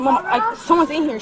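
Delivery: imitated alarmed call. Retells sister's alarmed phone call.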